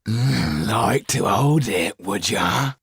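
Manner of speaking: thug's slow leering offer; taunting thug. Acted. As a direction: taunt him with the glass eye. Thug taunts with glass eye.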